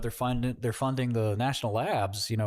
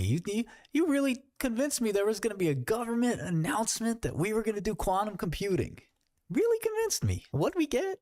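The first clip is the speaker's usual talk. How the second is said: much higher whiny complaint; mock betrayed investor; 7 semitones higher than usual. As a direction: whine like a burned bagholder.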